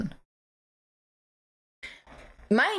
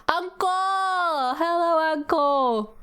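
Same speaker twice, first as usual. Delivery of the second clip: high, bright call to a friend; excited greeting; 9 semitones higher, slower than usual. Real, not acted. Streamer greeting a chatter called uncle, high and excited. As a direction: sing out the hello.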